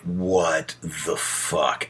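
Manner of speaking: a partner's slow, exasperated disbelief; drawn-out baffled "what the fuck". Retold or imitated. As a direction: let it hang in slow exasperation. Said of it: "the other days you're like".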